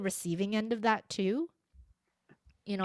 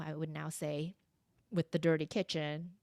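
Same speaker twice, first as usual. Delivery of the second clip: usual speaking voice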